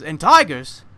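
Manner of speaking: loud nervous question; a man adds to the fear, loud; man's voice. Acted. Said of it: "One of the men says".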